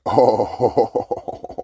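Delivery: drawn-out oh breaking into chuckles; reenacted triumphant laugh on a long oh; gleeful chuckle. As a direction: chuckle through it, savoring the moment. Reliving the ambulance starting to budge.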